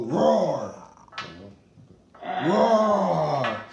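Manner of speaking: loud, slow, dragged-out dinosaur roar. Acted. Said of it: "we have to roar like a dinosaur".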